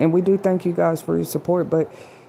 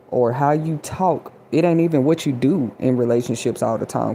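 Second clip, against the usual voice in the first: ordinary talk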